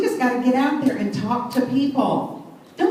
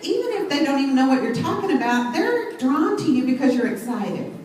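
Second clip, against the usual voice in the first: normal talking voice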